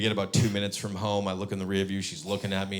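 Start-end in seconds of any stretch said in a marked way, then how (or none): none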